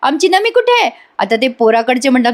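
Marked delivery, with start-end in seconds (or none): 0.0-0.9 high-pitched mock scolding; retold annoyance in a raised pitch; annoyed aunt. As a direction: act out an indignant aunt demanding answers, pitched well up. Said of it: "आता ती आत्या चिडते आणि म्हणते".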